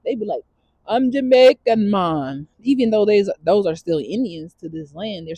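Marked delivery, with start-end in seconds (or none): 0.9-2.5 lightly imitated Jamaican accent, drawn out. Mimicking a New Yorker's other dialect, imitated Jamaican accent. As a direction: put on a slow Jamaican lilt. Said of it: "they got a whole nother dialect".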